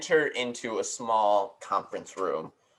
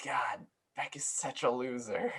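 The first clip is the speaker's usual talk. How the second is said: slow, whispered jeer; crowd murmur; 8 dB quieter than usual. Acted. Game master voicing the crowd about Beck, slow whisper. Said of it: "you hear from the crowd".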